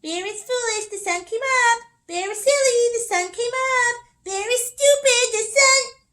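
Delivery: high loud mocking singsong; teasing. Little Brown Squirrel taunting Bear, high loud mockery. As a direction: taunt him in a high sing-song. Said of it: "began to tease Bear".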